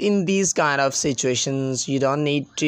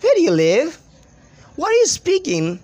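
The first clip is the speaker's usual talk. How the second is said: high, swooping affected English accent; playful put-on accent, much higher questions; put-on foreign accent; 8 semitones higher than usual. Shown as a demonstration. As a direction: show off a fancy accent with lifted pitch. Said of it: "so I enjoy speaking like that".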